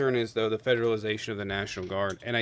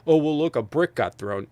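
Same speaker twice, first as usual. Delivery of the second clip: mocking; 4 semitones higher, 7 dB louder than usual. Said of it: "to watch people go".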